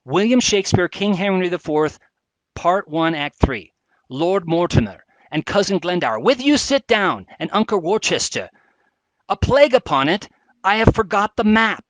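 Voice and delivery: put-on theatrical reading of an old play; dramatic reading. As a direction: declaim the lines like a stage actor. Reads Shakespeare scene aloud.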